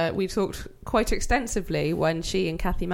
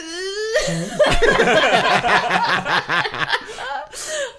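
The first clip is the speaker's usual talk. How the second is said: shrieking high laugh fit; real laugh, way up high and loud; supersonic laugh; 12 semitones higher, 7 dB louder than usual. Real, not acted. Laughing at the haircut joke, supersonic shriek. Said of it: "I just laughed in supersonic".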